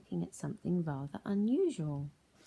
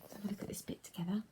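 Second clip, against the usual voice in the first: fast whispered aside; 3 semitones higher, 6 dB quieter, much faster than usual. Real, not acted. Teacher to children, fast whisper. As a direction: murmur it in a hush.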